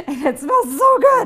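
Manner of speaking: giggling delight; laughing. Real, not acted. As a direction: laugh through the words. Smelling bacon, laughing.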